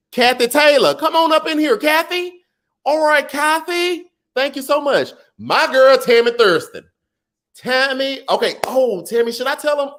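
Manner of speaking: very high, gushing greeting; excited squeal. Real, not acted. Greeting donors Kathy and Tammy in a very high, delighted squeal.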